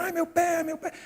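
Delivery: high mock child's cry, trailing away; imitated wailing. Boy who will not stop yelling, mock wailing. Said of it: "O tempo todo gritando".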